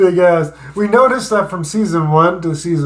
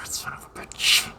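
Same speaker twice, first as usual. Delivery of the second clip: unvoiced muttered curse; whispered curse; 12 dB quieter than usual. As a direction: curse under your breath. Muttered under breath.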